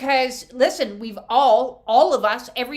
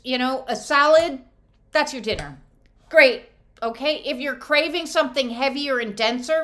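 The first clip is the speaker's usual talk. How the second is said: ordinary delivery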